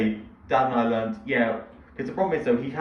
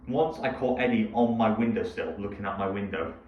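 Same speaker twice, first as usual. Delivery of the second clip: normal talking voice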